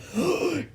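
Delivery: high sharp gasp; outraged gasp. A narrator's reading. Mister Cranky gasps at being told to calm down. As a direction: gasp in disbelief.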